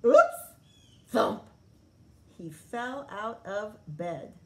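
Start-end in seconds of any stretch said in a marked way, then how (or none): 0.0-0.5 sudden high loud yelp; a startled little yelp; surprised yelp. A narrator's reading. Froggy falls out of bed. 1.1-1.4 a heavy landing sound; loud thump. A narrator's reading. Sound of falling out of bed.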